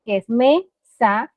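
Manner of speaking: mesa drawn out slowly, syllable by syllable; slow syllable tapping. Shown as a demonstration. Therapist to a small child: slow, syllable-marked mesa. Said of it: "Uno le puede marcar".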